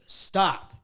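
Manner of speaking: loud, firm stop; angry stop. Retold or imitated. Fed-up partner ordering her to stop, loud and firm. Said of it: "I got mad".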